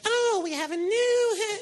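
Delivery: very high, slow sing-song teacher; teacher's voice. Retold or imitated. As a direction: chirp it in a high sugary teacher voice. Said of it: "that's what her voice is really like".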